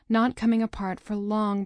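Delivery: ordinary delivery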